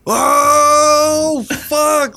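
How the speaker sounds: loud, high, long drawn-out "oh"; stretched cry of astonishment; mind-blown mock reaction. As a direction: imitate someone losing it in amazement. Retold mind-blown reaction to frying bologna.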